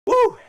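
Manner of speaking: loud, high-pitched whoop; fired-up whoop. Real, not acted. Host fired up on a live fan show, excited whoop. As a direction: let out a big excited "woo". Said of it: "I'm getting fired up".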